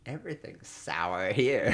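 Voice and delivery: slow, deadpan muttered aside; dry aside. Acted. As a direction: say it slow and sardonic.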